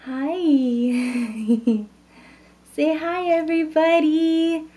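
put-on high sing-song baby talk to the newborn. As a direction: coo a greeting on the baby's behalf. Mother voices greeting to newborn.

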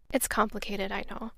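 usual speaking voice

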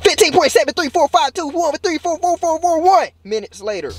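high, rattled-off number announcement; mock time card. Vlogger announcing time passed, mock narrator voice. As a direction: reel off digits like a silly caption.

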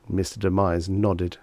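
plain reading voice

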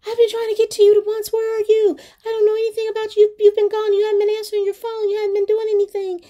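very high, rushed complaining female voice; hammed-up high nagging on the phone; frantic woman caller. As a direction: reproach him in a high-pitched put-on voice. Woman on phone scolding him.

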